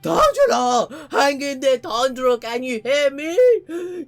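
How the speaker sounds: high, loud, drawn-out cry; hammed-up shouting to a dying friend; desperate calling out. Healer calls out to Tanjiro.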